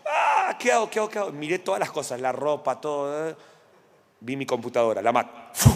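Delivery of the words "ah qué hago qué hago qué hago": hammed-up panicked cry, much higher; mock himself frantic and lost; panicking. Pastor mocking his own panic during the hotel fire, high frantic cry. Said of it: "yo estaba así como".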